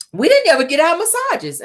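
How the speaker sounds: high-pitched imitated complaint; mock whining. Tiffany complaining about missed massages, high mock whine.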